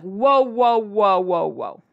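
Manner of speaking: loud alarmed whoa, whoa, then fading off; wife halting her husband, loud then fading. Retold or imitated. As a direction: stop him short, then let it die away. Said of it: "And I'm like".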